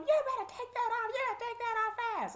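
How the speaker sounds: high, loud, rapid threat; mimicked guard's shrill-pitched order; imitated hollering. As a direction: holler it like an impatient sheriff. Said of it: "screaming and hollering like".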